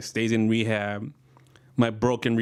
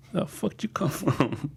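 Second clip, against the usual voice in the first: mock startled; 4 semitones higher than usual.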